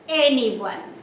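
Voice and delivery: Anyone with strong AN; first syllable stress. Shown as a demonstration. Teacher gives a three-syllable example, stress on AN in Anyone. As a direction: punch AN in Anyone, let the rest fall. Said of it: "Stress is on the first syllable".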